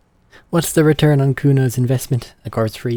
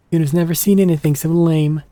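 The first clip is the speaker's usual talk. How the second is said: put-on higher, louder bratty street kid; cocky kid Kuno; 6 semitones higher, 5 dB louder than usual. Streamer voicing a cocky kid who shows off. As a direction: brag like a cocky little punk.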